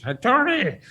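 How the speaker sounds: high-pitched mock summons; calling out. Milos phones Tony's room for help, calling out. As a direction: imitate a friend hollering for Tony. Retold phone call between rooms.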